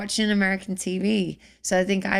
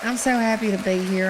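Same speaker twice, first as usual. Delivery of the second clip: Southern accent, done again on cue; 2 semitones higher than usual. Shown as a demonstration. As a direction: repeat it in a Southern accent on request. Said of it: "do that again".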